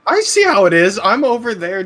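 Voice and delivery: loud call from a distance; calling from far away. Real, not acted. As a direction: shout it across the room. Shouting from across the room.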